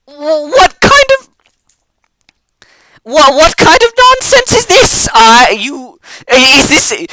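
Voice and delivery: a squeaky goblin in full indignant fury; outraged goblin. Acted. Goblin scolding the plasmoid who lifted him, shrill outrage.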